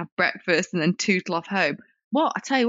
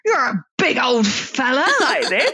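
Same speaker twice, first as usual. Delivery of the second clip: slow, loud, higher mock gawping; imitating a brash bloke; 5 semitones higher, 4 dB louder, slower than usual. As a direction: stretch it out, teasing the tall man. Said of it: "he was talking like that".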